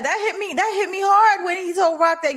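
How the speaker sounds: high, pained disbelief; emotional. Real, not acted. Fan hurt by the scene, high and pained.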